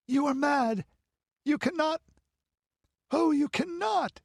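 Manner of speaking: very high, desperate protest; frantic high-voiced disbelief; horrified shrieking. A narrator's reading. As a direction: beg and protest in rising horror. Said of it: "shrieked Balessa".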